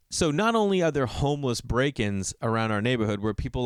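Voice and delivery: ordinary talk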